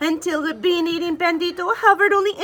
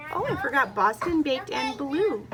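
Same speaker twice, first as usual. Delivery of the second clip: usual speaking voice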